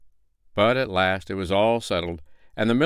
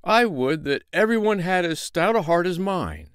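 quick proud agreement, pitched higher; not to be outdone; boastful tailor; 9 semitones higher than usual. Acted. Tailor matches the tinker's boast, higher and proud. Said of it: "said the tailor".

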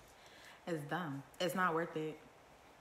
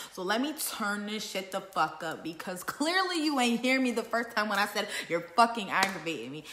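turned up; 5 semitones higher, 7 dB louder than usual. Retold or imitated. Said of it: "a calm, low voice, you're not hearing me".

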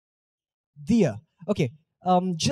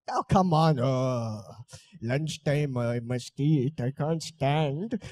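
imitated grumbling; 4 semitones lower, 4 dB quieter, slower than usual. Voices reluctant audience asked to stand.

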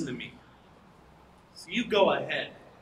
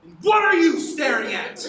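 angry snap; 8 semitones higher, 6 dB louder than usual. Acted. Husband snaps at the girl.

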